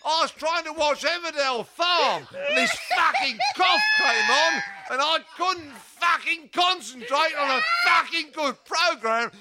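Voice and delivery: comic rant. Acted. Ranting chanted comic verse.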